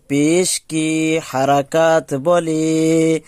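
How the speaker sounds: slow, drawn-out rote chant; slow recitation. Shown as a demonstration. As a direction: chant it slowly for students to memorize. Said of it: "এক কথা আমরা মুখস্থ করে নেই". (ধীরে ধীরে মুখস্থ করানোর সুর)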